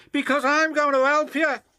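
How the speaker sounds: high, loud, fast engine voice; eager helpful James. Acted. James promising to help Thomas, high loud eager voice.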